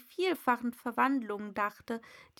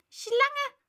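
high, loud bird-like screech; shrill outburst from a pigeon; 6 dB louder than usual. A narrator's reading. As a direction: shriek it out as an alarmed pigeon. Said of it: "kreischte die Taube".

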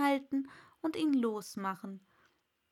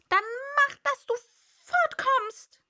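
high, loud, vexed bird voice; irritated pigeon dismissal; grumpy pigeon; 8 dB louder than usual. A narrator's reading. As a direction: send her off crossly, with pauses. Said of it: "sagte die Taube verdrießlich".

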